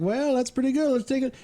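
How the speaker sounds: put-on old farmer doctor. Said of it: "He's like".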